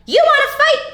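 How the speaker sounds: high, squeaky challenge; grouchy ladybug. Acted. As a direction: pick a fight in a tiny voice.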